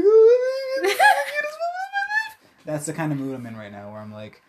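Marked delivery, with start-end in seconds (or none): 0.0-2.7 squealing far higher and louder, laughing and crying at once; overjoyed squeal. Retold or imitated. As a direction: gush like the cutest moment ever, voice breaking into a laugh-cry. Gushing over a baby's hug.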